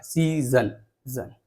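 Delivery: "Season" with a drawn-out "सी", then "जन" alone; stress on first syllable. Shown as a demonstration. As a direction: lean slightly on "सी", let "जन" drop. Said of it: "थोड़ा सा जोर आ रहा है".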